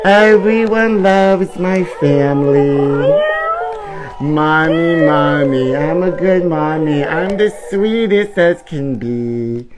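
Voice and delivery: sugary, drawn-out proud-mother voice; doting mommy character. Acted. Mother cooing to her babies, sugary and slow. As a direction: coo over the babies like a smug mother.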